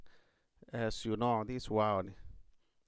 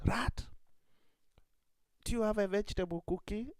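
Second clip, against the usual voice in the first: higher, quick rabbit voice; timid rabbit; 9 semitones higher, faster than usual. Acted. Hungry rabbit asks the rat again, high and quick. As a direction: ask fast in a small high rabbit voice.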